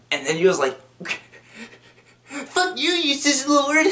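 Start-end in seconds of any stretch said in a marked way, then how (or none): 2.3-3.9 high, squealed insult; mock enraged outburst. Tumblr user answers being proved right, mock rage. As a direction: imitate a furious poster in falsetto.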